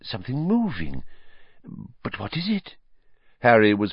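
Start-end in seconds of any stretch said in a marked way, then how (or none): 0.0-2.8 lightly voiced high, softer ominous wonder; mysterious seer. Trelawney gazing into ball, soft ominous wonder.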